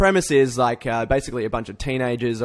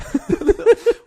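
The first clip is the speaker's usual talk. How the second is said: a sudden squeal of laughter; burst of high laughter; 7 dB louder than usual. Real, not acted. Laughs at scared friend.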